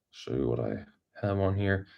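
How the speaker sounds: usual speaking voice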